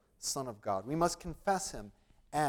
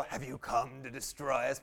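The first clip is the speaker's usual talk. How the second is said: lower, menacing demon impression; 4 semitones lower, 5 dB quieter than usual. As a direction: growl it like a movie demon. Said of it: "I would just wanna go".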